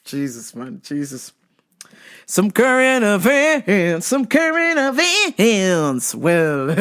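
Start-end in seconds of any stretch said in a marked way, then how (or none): 2.3-4.1 stretched-out show announcer tone; slow announcer. Shown as a demonstration. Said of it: "drawn-out segment intro". 4.1-6.1 even slower, higher drawn-out intro; slower announcer. Shown as a demonstration. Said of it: "repeat, slower and higher".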